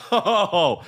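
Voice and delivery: breaks into a high laugh; a real laugh on oh. Real, not acted. Laughs at himself.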